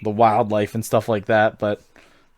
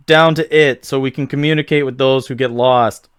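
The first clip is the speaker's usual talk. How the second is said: usual speaking voice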